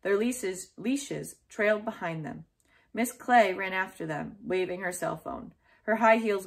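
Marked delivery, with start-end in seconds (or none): none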